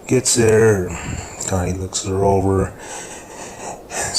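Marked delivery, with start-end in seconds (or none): none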